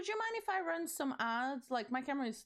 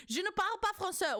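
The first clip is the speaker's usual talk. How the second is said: fast, higher French attempt; French phrase; 6 semitones higher, 4 dB louder, faster than usual. Shown as a demonstration. Streamer showing chat her French, said fast and a bit higher. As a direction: show off a quick French line. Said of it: "Was that good?".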